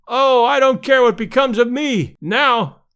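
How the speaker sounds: high, loud hysterical outburst; hysterical cry. A narrator's reading. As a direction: cry it out in wild relief. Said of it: "cried out hysterically".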